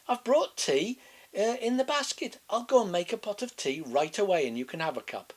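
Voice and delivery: put-on high, chirpy little girl. A narrator's reading. Girl telling the wolf about grandma, high innocent voice. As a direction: sing it out like an innocent child.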